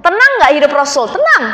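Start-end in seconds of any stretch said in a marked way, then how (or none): 1.1-1.4 emphatic answer. Acted. Answers own question emphatically.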